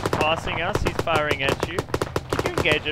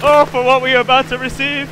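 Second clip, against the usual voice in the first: mock-solemn loud prayer; 6 semitones higher, 8 dB louder than usual. Bracing for enemy fire.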